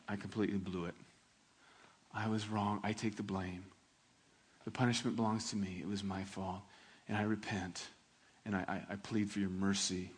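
low, soft, broken confession of guilt; humble repentance. Acted. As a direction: plead quietly for mercy.

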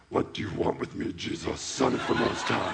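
rasping, whispery low demon voice. Acted. Possessed man confronting Jesus, hammed-up demon voice. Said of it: "I'm gonna use my demon voice".

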